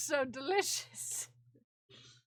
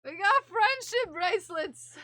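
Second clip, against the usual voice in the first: loud, higher delighted cry; excited; 5 semitones higher, 9 dB louder than usual. Real, not acted. Finding friendship bracelets in the box, loud delight. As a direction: burst out with the good news.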